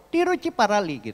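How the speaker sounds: "Tiruchiparalli" pitched high, falling at the end; mimicked place name.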